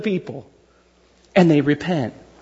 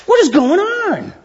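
pitched-up, drawn-out what the heck; mock bewildered, slow and high; baffled outrage; 11 semitones higher, slower than usual. Outraged churchgoer hearing about stolen aid, pitched-up and slow. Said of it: "you're really going".

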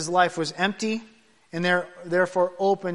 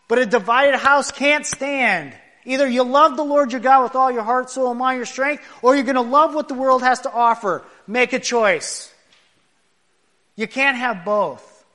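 preaching at full force; 5 semitones higher, 6 dB louder than usual. Acted. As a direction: thunder it to the congregation.

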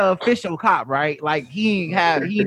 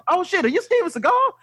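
starstruck; 12 semitones higher than usual. Retold or imitated. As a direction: voice jumps way up in excited disbelief. Star-struck man recognizing Seagal.

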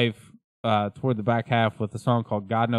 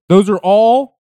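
loud emphatic; 8 semitones higher, 10 dB louder, slower than usual. Real, not acted. Emphatic outburst.

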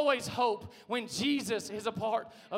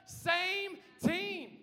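high, drawn-out call, only lightly acted; a hint of the crazy sideline guy, pitched up; lightly voiced sideline guy; 6 semitones higher, much slower than usual. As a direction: call it out like a mild sideline heckler. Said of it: "be that crazy guy on the sidelines".

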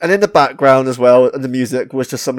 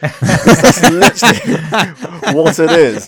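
laughing while talking; 3 semitones higher, 5 dB louder, much slower than usual. Real, not acted. Reviewer amused by his own metaphor, laughing through it. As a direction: crack up slowly through the line.

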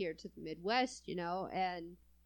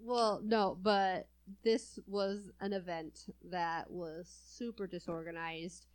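ordinary delivery

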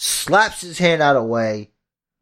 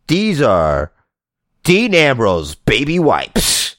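ad read; 2 semitones higher than usual. Acted. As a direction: sell the wipes like a TV ad. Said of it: "we cut to commercial".

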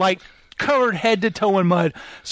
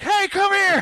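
very high chasing holler; urgent pitched-up mock yell; calling after someone; 14 semitones higher than usual. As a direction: shout after a distant runner without real volume. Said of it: "I'm like".

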